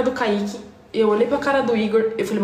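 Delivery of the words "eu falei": normal talking voice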